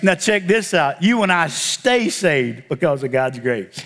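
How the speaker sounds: ordinary talk, nothing marked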